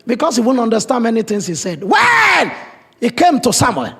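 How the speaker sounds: loud preaching, pitch pushed high; rising to a fiery sermon peak; full-force preaching. Acted.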